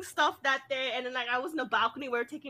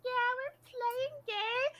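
put-on high, softer, slow child voice; imitated little kid; 12 semitones higher, 5 dB quieter, slower than usual. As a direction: mimic a kid answering slowly. Voices a neighbour kid answering.

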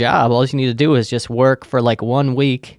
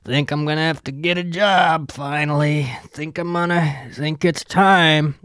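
drunk neighbor impression; 3 semitones higher than usual. Neighbor Paul announcing he might get a job, lazy drunk voice. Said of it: "he's like".

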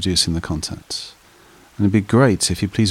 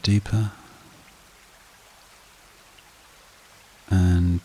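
guided meditation; 4 dB quieter than usual. Acted. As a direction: lull them evenly, softening as each phrase settles. Leads a breathing meditation.

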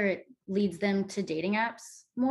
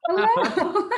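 a laughing, hammed-up Shrek voice, higher and louder; mock Shrek Scottish accent; 11 semitones higher, 9 dB louder than usual. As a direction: blurt a bad fake Scottish "hello" like Shrek. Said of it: "imitating Shrek".

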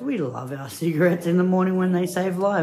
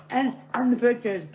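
ordinary talk

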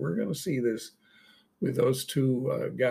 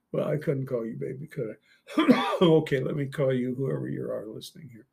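ordinary delivery

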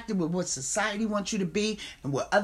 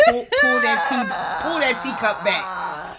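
dramatic plea; 5 semitones higher, 8 dB louder than usual. Acted. Playful demand for the teacup.

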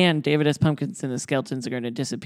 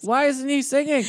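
pitched way up, whining; high whiny voice; 12 semitones higher than usual. Retold or imitated. Scared kids wondering why no song, high whine.

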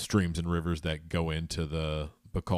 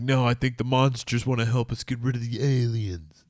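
a raised, hammed-up movie-character line; movie hero line; 6 semitones higher, 4 dB louder than usual. Vlogger acting out a monster movie line, hammed-up and raised. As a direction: pitch it like a dramatic film actor.

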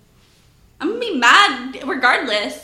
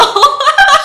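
high, loud burst of laughter; real big cackle; 14 semitones higher, 11 dB louder than usual. Real, not acted. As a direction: crack up loudly. Laughing after jail punchline.

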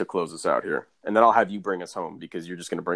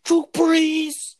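high, slow, drawn-out taunt; high squeaky mock taunt; Ed Reed impression; 5 dB louder, much slower than usual. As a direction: stretch it out mocking him as a sucker. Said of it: "Ed Reed got on YouTube and was like".

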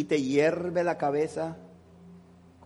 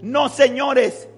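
loud, high fervent preaching with slow stretched phrases; preaching at full force; 8 semitones higher, 12 dB louder, slower than usual. Acted. Pastor preaching to the congregation at full force. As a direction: thunder it out like a revival preacher.

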